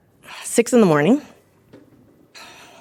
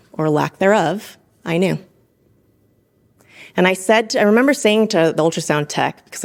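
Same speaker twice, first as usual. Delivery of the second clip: normal talking voice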